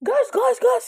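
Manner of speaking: high-pitched mock scream, held back in volume; imitated panicked yell. Friend screaming at the rider to speed off, high mock yell. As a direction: urge them to flee in a shrill retold shout. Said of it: "teriak nih temen aku ya kan".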